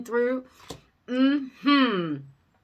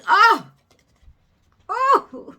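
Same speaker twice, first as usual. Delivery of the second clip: sudden high gasp of surprise; surprised gasp; 10 semitones higher, 9 dB louder than usual. Real, not acted. Reader reacting to the cards, surprised gasp. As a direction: gasp at what the cards show.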